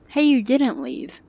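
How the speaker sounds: ordinary talk, nothing marked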